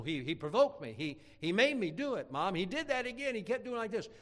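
high, aggrieved excuse to mom; imitated kid's protest. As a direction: plead like a tattling child, pitch climbing. Child pleading his case to mom.

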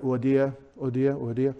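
Euodia quick and run together, oo-DEE-ah; fast native pronunciation. Shown as a demonstration. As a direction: rattle off Euodia casually, vowels elided. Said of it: "Probably something fast like that".

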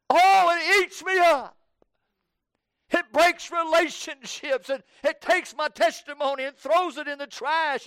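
high, building to full-force preaching cry; impassioned confessing prayer. Acted. Sinner confessing to God what sin costs, impassioned outcry.

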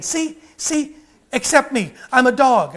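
a cat begging to pass as a dog; mock cat pleading; imitating a cat. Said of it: "a cat barking and saying".

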